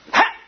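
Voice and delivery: short, high puff of release; sudden "ha" burst. Retold or imitated. Preacher imitating a minister releasing power onto someone, sudden "ha" burst. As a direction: imitate a quick explosive "ha". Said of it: "he'd go".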